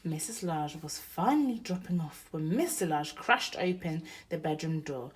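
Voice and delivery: usual speaking voice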